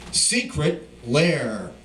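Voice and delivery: secret lair drawn out slowly, each word weighted; in caps. Acted. Poet marks the phrase in caps for the audience, slow and weighted. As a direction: say secret lair as if in capital letters. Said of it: "I'll write it in caps".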